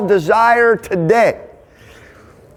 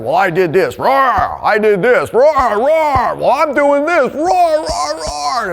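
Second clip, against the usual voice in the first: higher, mocking boast building up to "roar, roar"; mock braggart; 5 semitones higher, slower than usual. Leader mocking forty-year-old men reliving college glory, higher mock boast. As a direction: lampoon a middle-aged guy still bragging like in college.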